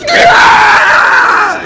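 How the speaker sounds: loud high-pitched shriek; long piercing scream, imitating a panicked official. Official seeing the file dumped on her desk, screaming. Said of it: "screaming at the top of her lungs".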